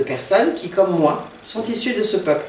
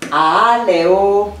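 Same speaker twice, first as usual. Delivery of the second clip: ALEO loud, with a long stressed opening A; louder, drawn-out ALEO leaning on the A; 2 semitones higher, 6 dB louder than usual. Shown as a demonstration. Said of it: "l'accent tonique serait sur le A".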